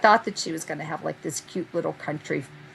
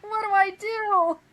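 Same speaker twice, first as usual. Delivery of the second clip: high, slow, whiny mock wail; mock despair. Torn over the boxes, a high mock-despairing wail. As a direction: plead it like a lost child.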